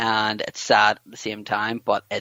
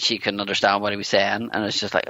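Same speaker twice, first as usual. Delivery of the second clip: ordinary talk, nothing marked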